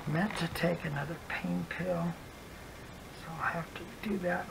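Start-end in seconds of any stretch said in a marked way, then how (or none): none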